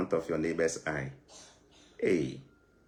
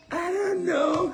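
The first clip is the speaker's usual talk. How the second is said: put-on high, slow, wailing child's "I don't know"; high sobbing child voice; whiny crying child; 4 dB louder, much slower than usual. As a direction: wail it like a crying kid. Whiny cry voice.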